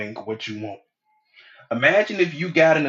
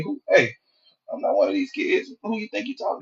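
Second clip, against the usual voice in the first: high, sharp and offended, then dropping to a hiss; defensive snap; 8 semitones higher than usual. Retold or imitated. Snapping back at his girl when she picks a fight. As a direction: bristle at being barked at like a child. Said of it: "my initial reaction gonna be".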